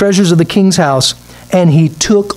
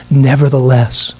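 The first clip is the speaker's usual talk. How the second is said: ordinary delivery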